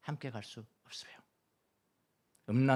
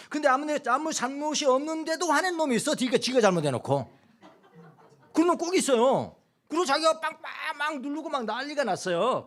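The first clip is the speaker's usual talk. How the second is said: indignant rant; 14 semitones higher, 8 dB louder than usual. Retold or imitated. Indignant about rude drivers.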